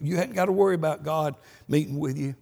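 ordinary talk

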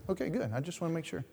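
ordinary talk, nothing marked

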